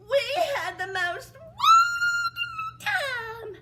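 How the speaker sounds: high, loud, slow gush; gushing stepsister. Acted. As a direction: preen over the party.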